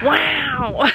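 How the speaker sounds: loud, high "Wow!" in jest; mock surprised wow. Real, not acted. Reacting to the Owen Wilson joke, loud playful wow. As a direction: react like you've been roasted.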